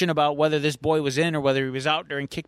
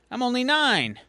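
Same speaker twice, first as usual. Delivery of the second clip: put-on higher, light kid voice; lightly voiced little boy; 8 semitones higher than usual. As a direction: say it like a hopeful nine-year-old. Kid's first take.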